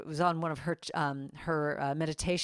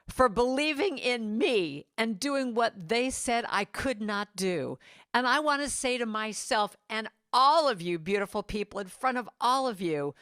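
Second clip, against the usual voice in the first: louder and higher, whooping on "you go"; Niecy Nash's triumphant speech; 4 semitones higher, 4 dB louder than usual. Retold or imitated. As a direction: cheer yourself on from the stage. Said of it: "She said".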